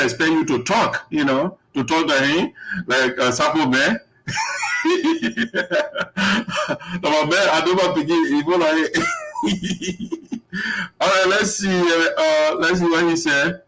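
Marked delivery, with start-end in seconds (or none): 4.3-7.0 real high, wheezy laughing fit; long burst of high laughter. Real, not acted. Amused after his Sapo man remark. 9.0-11.0 soft, high real giggle; trailing high-pitched laughter; laughing. Real, not acted. Still amused, cannot go on.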